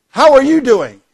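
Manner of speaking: high, loud and far too cheerful hello; cheery patrolman greeting. Retold or imitated. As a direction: greet him brightly as the trigger-happy patrolman. Patrolman greets driver after shooting.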